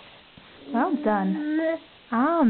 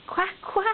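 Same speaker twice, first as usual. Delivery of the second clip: higher, playful quack quack; duck quack for a toddler; 7 semitones higher than usual. Shown as a demonstration. Parent showing her toddler the duck sound, playful quack. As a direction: show the little boy how a duck goes. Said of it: "And a duck?".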